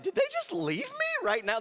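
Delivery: very high-pitched incredulous squeal; mock disbelief. Teen finding friends gone, high-pitched disbelief. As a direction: squeak it out, abandoned and aghast.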